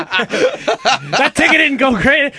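repeating it through laughter; laughing. Retold or imitated. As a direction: giggle the line out slowly. Repeating caller's line laughing.

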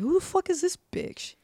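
high-pitched imitation of being taken aback; retold incredulous reaction. Newcomer reacting to a cussing older lady, incredulous. Retelling first shocked reaction to sponsor.

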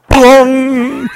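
loud, high mock crash sound; ringing "bong" of hitting a window; imitated impact noise.

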